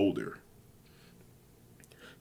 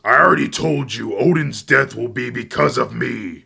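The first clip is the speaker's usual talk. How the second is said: loud, high, fast outburst; angry yell; 5 semitones higher, 11 dB louder than usual. A narrator's reading. Fenrir yells at his child about Odin, loud angry yell. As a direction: roar it out at full force, rushing. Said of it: "Fenrir grunts and he yells".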